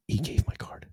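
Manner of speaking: whispered aside under the breath. Real, not acted. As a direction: zacht gefluisterd, alsof niemand het mag horen.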